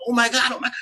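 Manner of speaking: panicked gasp. Retold or imitated. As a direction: blurt it out in alarm. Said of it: "I'm like".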